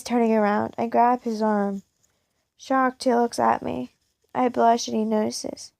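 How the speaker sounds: ordinary delivery, nothing marked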